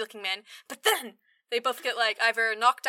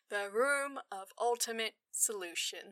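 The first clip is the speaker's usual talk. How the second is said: slow, soft solemn read; a hint of solemn Pygar, slow and soft; 8 dB quieter, slower than usual. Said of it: "He's like".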